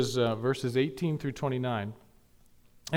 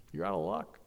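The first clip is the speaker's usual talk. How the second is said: father's dismissal; 8 dB quieter than usual. Retold or imitated. Father voiced in retold story.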